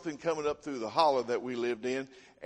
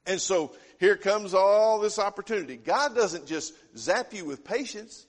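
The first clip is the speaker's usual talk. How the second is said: higher, louder preaching build-up; emphatic preaching; 6 semitones higher, 6 dB louder than usual. Acted. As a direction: drive the point home from the pulpit.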